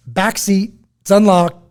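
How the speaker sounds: urgent shout. Retold or imitated. Dad shouting to a bystander to grab the med kit. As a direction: call across to the guy with urgency.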